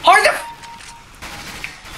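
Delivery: high, breathless, choked-off fury; the words catch in your throat with rage; strangled rage. Real, not acted. Rage at teammate Harry.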